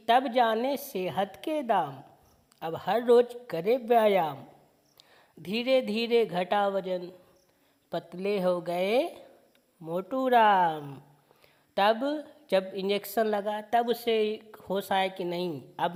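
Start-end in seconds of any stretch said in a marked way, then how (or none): 0.1-11.0 slow, sing-song poem reading; poem recitation. Acted. Teacher reciting a children's poem slowly to students. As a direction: recite each line slowly for the class.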